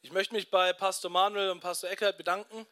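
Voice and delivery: ordinary delivery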